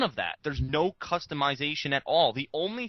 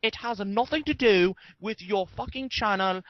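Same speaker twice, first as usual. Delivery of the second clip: imitating an angry commenter in a put-on British accent, higher; hammed-up British accent, ranting and pitched up; mock British accent; 7 semitones higher than usual. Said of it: "not necessarily in the British accent".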